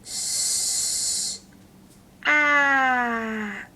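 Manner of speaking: S as a long hiss then At; sounding out. Shown as a demonstration. Teacher sounds out sat in two parts for children. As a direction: split sat into S and At. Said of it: "Say that again".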